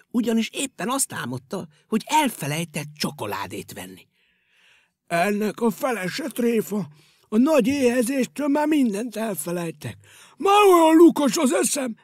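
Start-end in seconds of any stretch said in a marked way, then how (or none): 5.1-12.0 high, rising fretful character voice; a round sweet-toothed hero getting worked up; Gombóc Artúr's voice. Acted. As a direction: fuss louder and louder about forgetting. Gombóc Artúr wakes hungry.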